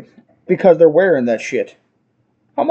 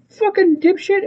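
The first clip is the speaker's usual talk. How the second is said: exasperated insult; 14 semitones higher than usual. Retold or imitated. Exasperated insult after customer's answer.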